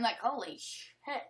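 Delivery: usual speaking voice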